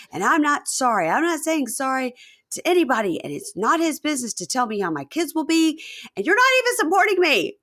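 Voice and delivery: high-pitched mock ranting; Mahmoud's meltdown. Husband ranting to his wife about critics, mock meltdown. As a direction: build an aggrieved tirade to a loud finish. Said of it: "a total meltdown".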